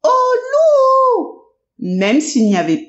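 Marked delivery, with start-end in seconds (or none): 0.0-1.4 piercing high cry of wolf; high shrieking cry. Acted. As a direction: shriek it like a child crying wolf. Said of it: "il criait". (cri perçant et aigu)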